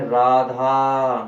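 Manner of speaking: Radha drawn out very slow and loud, calling across a distance; calling out far. Shown as a demonstration. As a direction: call Radha to someone far away, stretching the last aa. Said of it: "किसी को पुकारें". (दूर खड़े व्यक्ति को लंबा पुकारना)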